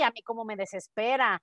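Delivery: ordinary talk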